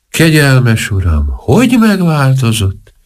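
slow, sorrowful lament; a touch of sadness, slow; sad. A narrator's reading. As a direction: grieve gently over his appearance. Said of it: "mondta szomorúan a portás felesége".

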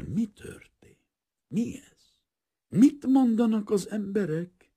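high-pitched, stunned questions with gaps; bewildered. A narrator's reading. Painter wondering at what is happening, deeply bewildered. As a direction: ask in dazed astonishment, pitch lifted. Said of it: "Valami mélységes csodálkozás töltötte el".

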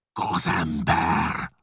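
Csát bellowing at the forger; roaring. A narrator's reading. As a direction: roar it so the house shakes. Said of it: "ordította olyan hangon".

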